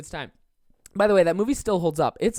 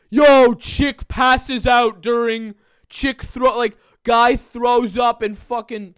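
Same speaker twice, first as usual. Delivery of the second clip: loud, higher, drawn-out viral-video yell; mock hype shouting; 4 semitones higher, 9 dB louder, much slower than usual. Imagined viral video showing off a fainting, hyped-up mock shout. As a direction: holler it like a hyped-up clip poster.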